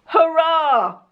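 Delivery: high, delighted little hurrah; excited cheer. Real, not acted. YouTuber cheering a full-size advent gift, high and delighted. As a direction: cheer it like you just won.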